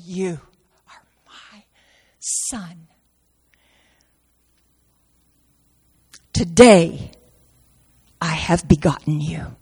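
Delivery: whispered are my son, then a stronger today; the Father tenderly telling the Son he is begotten; tender hush. Acted. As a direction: breathe it like a secret of love.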